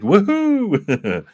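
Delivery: high gleeful cheer; a big happy cry of celebration; excited whoop. Pretend. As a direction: whoop it up like a delighted fan. Client imagined cheering about Australia.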